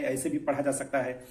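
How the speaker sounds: ordinary talk, nothing marked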